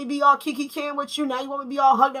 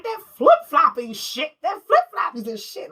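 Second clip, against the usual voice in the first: movie quote impression; 3 semitones higher, slower than usual. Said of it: "Vampire in Brooklyn, he was like".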